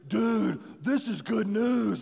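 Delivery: slow, drawn-out dude-style wonder; mildly hammed-up astonished realization; imitated amazement. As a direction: stretch it out like an amazed buddy. Buddy amazed on hearing the gospel.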